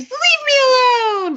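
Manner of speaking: high, loud mock scream. Babysitter screaming into the phone at the stalker. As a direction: shriek it at the caller. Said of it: "picks it up and screams".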